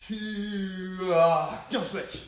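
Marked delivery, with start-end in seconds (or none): 0.1-1.1 long drawn-out karate cry; slow-motion kiai. Shown as a demonstration. Coach punching in slow motion with kids, slow-motion kiai. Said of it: "I like that slow motion key out".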